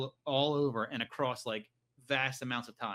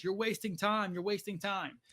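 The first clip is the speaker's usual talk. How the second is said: freaking out; 6 semitones higher than usual. Retold or imitated. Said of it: "John's freaking out".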